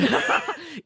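short high giggle; high laugh. Real, not acted. Laughs at reply.

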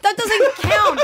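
laughing, high protest; annoyed laugh. Real, not acted.

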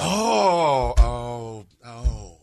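loud, high drawn-out oh; mock realization. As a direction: pretend you just got it. Mock realization after put-down.

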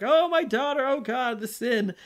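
high-pitched mock lament, easing down; praying mothers. Moms praying with friends for their daughter, mock anguished prayer. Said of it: "praying for their daughter in".

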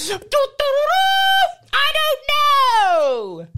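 very high and very slow; high drawn-out voice. Acted. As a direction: stretch it out squeakily. Dwarf character in improv.

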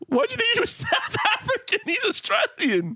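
high, incredulous laughing protest; laughing disbelief. Real, not acted. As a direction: challenge them through helpless laughter. Incredulous at friend's mistake.

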